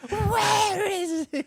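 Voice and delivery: very high, drawn-out demand through laughter; mock enraged mom. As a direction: mimic a raging mom, laughing. Listener mimics the raging mom.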